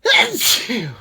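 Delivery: loud real sneeze; big explosive sneeze; sneezing. Real, not acted. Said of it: "comes some more".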